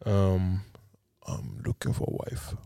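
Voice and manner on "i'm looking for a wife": very low, half-whispered morning voice; deep, croaky just-woke-up morning voice. Real, not acted. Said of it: "this is my morning voice".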